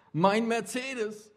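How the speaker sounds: slow, drawn-out wail over the lost car. A narrator's reading. Young man wailing to the policeman over his car. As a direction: lament it like a ruined man. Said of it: "jammerte der junge Mann".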